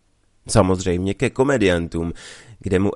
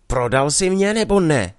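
a raised, higher demand; half-shouted accusation at her sweetheart; mock cry out; 6 semitones higher, 3 dB louder than usual. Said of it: "vykřikla".

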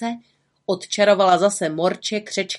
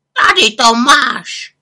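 much louder, slow croaking raven; 5 semitones higher, 9 dB louder, slower than usual. Acted. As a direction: croak out a told-you-so. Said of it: "zakrákal Abraxas".

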